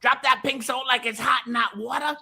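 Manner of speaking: high, hammed-up country pitch; excited put-on country voice, pitched high. Telling Vic how to mix it, high country voice. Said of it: "I'll do it in the country voice now".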